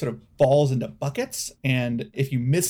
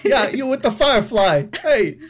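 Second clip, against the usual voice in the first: loud, high-pitched hail; calling out; 9 semitones higher, 10 dB louder than usual. Acted. Crocodile hails the visitor with the firefly, loud high call. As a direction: shout to get the attention of someone hiding.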